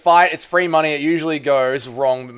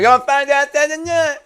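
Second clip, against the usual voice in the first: Louisiana voice; 11 semitones higher than usual. Pretend. Louisiana voice again.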